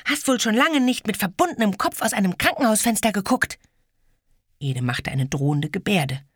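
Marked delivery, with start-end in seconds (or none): 0.0-3.6 taunting. Acted. Girl taunts boy.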